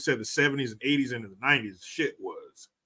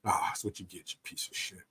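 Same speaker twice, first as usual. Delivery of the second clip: whisper; 5 semitones lower, 11 dB quieter than usual. Real, not acted. Scorn after the retold execution.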